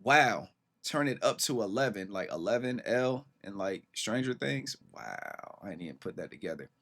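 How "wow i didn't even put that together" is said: much softer, fast muttered aside; muttering to himself. Real, not acted. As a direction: say it under your breath. Muttering to himself after realization.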